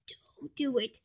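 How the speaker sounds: whispered, voiceless. Acted. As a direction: breathe it as a whisper.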